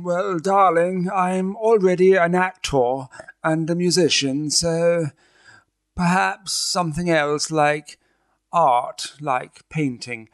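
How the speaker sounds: posh high-culture voice. Acted. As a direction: play the pompous artiste who can do anything. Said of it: "[name], please do it in a voice".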